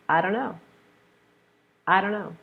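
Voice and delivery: "don't" with the t dropped, I dunno; reduced I don't know. Shown as a demonstration. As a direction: drop the t in "don't". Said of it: "So we drop off that T".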